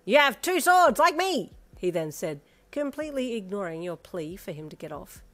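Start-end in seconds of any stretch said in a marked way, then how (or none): 0.0-1.5 put-on loud boy voice. A narrator's reading.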